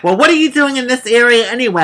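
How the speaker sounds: high, fast hectoring voice; mock hostile questioning. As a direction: imitate a pushy officer demanding an answer. Hostile officer butting in, retold.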